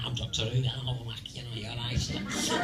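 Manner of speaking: lightly imitated Manchester accent, low. Working-class Doctor talking Manchester, low imitated Manchester accent. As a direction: put on a bit of working-class Mancunian. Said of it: "someone like Manchester, you know, talks like that".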